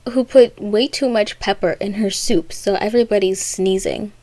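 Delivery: ordinary delivery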